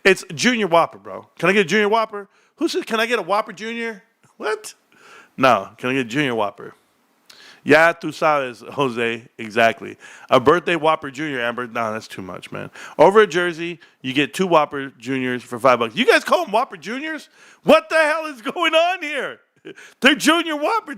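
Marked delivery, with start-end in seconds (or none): 1.4-2.3 demo order. Shown as a demonstration. Demonstrates his way of ordering. 2.6-4.7 high, squeaky-pitched scoffing quote; mock the wrong order way up high; mock disbelief. Streamer mocking those who say Whopper Junior, high disbelief. Mocks the other word order. 15.9-21.0 very high, stretched-out disbelief; mock outrage. Streamer scolding viewers over Whopper Junior, mock outrage.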